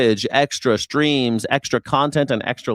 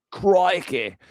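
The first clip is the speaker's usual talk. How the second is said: mock Australian; 7 semitones higher than usual. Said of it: "Aussie catchphrase".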